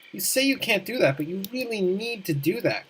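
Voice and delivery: ordinary talk, nothing marked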